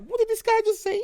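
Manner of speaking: high, incredulous "what did this guy just say"; a kid who can't believe his ears; stunned disbelief. Retold or imitated. Disbelief at policeman's remark.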